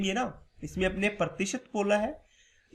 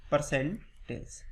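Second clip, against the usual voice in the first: Percentage stretched out at half speed; slow, lower percentage for the class; drawn-out repeat; 4 semitones lower, 4 dB quieter, much slower than usual. Shown as a demonstration. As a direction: say percentage slowly, drawing it out. Teacher repeats topic word after prompt.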